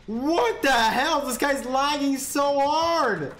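high, incredulous exclaiming with laughter; high-pitched, giggly astonishment; amazed outburst. Real, not acted. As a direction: wail in amused disbelief. Amazed at lagging player.

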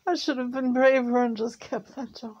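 high and pained, still regretful; grieving, almost breaking; upset. Real, not acted. Grieving over giving dog back.

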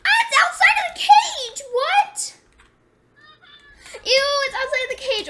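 high, loud laughing scream; shocked shriek. Real, not acted. Elephant escaped the cage.

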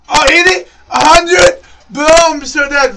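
high, loud disbelief; panicked shouting. Real, not acted. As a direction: yell it as the fight slips away. Panicking as fight is lost.

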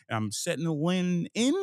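usual speaking voice

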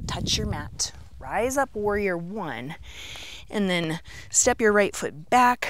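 ordinary delivery

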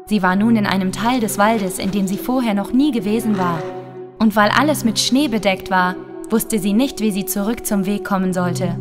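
usual speaking voice

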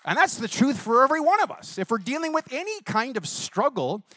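rally the congregation; preaching peak. Real, not acted. Preaching climax.

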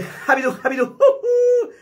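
very high, loud squeal; cartoon mouse squeal; squeaky mouse. Shown as a demonstration. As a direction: squeak out the name. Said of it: "El ratón jarocho ese".

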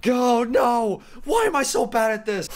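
high-pitched exasperated complaint; frustrated whine. Real, not acted. Player bad at this, high frustrated whine. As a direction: lament your own failure, voice pushed up.

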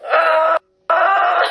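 high yelps melting into laughter; pained yelp and laugh. Real, not acted. Crunchy face mask hurts.

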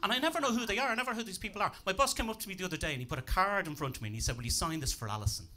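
ordinary delivery

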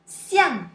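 xiang falling sharply from high, loud; fourth tone. Shown as a demonstration. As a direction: drop xiang hard from the top. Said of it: "Let's see more example".

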